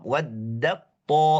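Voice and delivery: "Waddat ta" slow, t merged fully; drawn-out "Waddat ta" with assimilated t; idgham demo. Shown as a demonstration. Teacher repeating the idgham example, slowly. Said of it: "Makanya normal langsung idgham".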